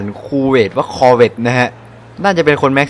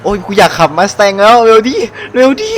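impatient excitement; 7 semitones higher, 3 dB louder than usual. Real, not acted. Eager to drive the Mustang.

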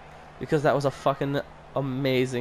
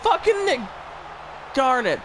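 frustrated shout; 11 semitones higher than usual. Real, not acted. Fan yells a player's name in angry frustration. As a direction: explode at the player.

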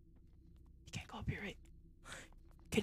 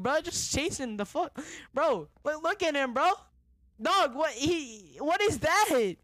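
raised-pitch, incredulous rant; indignant ranting; 4 semitones higher, 3 dB louder than usual. Real, not acted. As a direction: protest in disbelief, pitched up. Indignant rant at game enemy.

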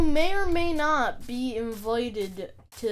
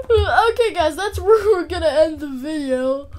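higher, louder, still laughing; 4 semitones higher, 9 dB louder than usual. Real, not acted. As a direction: push through the giggles. Still laughing while closing.

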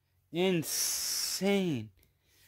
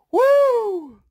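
very high, very loud joyful whoop; 12 dB louder than usual. Real, not acted. Collector celebrating his Charizard pull, loud whoop. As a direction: let out a triumphant scream. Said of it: "need to calm down a little bit".

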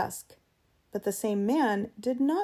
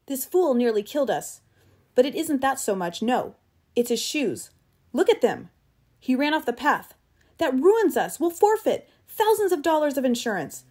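high, loud, agitated tirade; angry rant; 4 semitones higher, 5 dB louder than usual. Acted. Travis raging that Eccles left the path, angry rant. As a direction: rail in outrage, pitch and volume up. Furious guide rants about Eccles.

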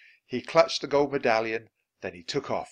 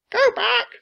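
very high, loud bird voice; parrot squawk; 10 dB louder than usual. A narrator's reading. Parrot warns the pirates, squawking high and loud. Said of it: "squawked Polly".

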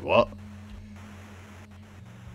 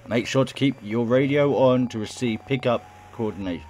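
radio announcer; 2 semitones higher than usual. Acted. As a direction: announce it like an emergency broadcast. Reads radio broadcast in game.

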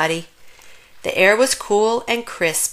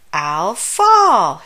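mild shout; 5 dB louder than usual. Acted. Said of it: "shouted Buddy".

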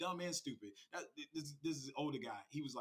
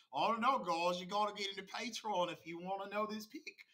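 higher-pitched put-on accent, loud opening; Archie impression, fake accent; 6 semitones higher, 5 dB louder than usual. Streamer mocking Archie's Patreon pitch to viewers, fake-accent impression. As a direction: mimic a fake accent, pitched up and punched at the start. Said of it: "I gotta say it like Archie".

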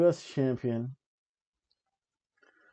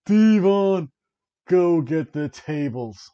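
higher, louder, slow drawn-out call; imitating the call; 5 semitones higher, 6 dB louder, slower than usual. Announcer voice calling for tables, hammed-up. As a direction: call it out like the famous catchphrase. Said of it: "You can almost hear".

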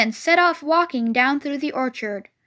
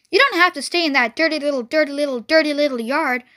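chattering goose, words tumbling out; fast-talking goose; faster than usual. Acted. Goose urging Wilbur out of his yard, fast chatter. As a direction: rattle off the first line fast, repeating yourself. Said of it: "who talked rather fast".

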